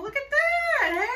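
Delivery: high, loud and slow with delight; excited. Real, not acted. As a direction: gush over the bird, loud and high. Bird tearing into the toy.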